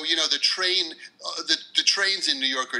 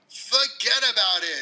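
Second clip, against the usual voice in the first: slow, punchy New York "Forget about it", higher; New York accent; 7 semitones higher than usual. Shown as a demonstration. Guest teaches slang phrase to students, New York accent. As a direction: drag out "Forget" in tough New York style. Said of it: "What else do students need to know".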